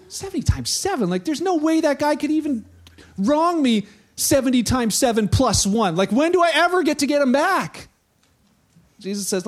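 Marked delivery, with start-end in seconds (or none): 0.1-7.9 higher, rising outburst of disbelief; incredulous Peter. Acted. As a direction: protest it, building to a loud, exasperated finish. Peter acted out, outraged at Jesus' answer.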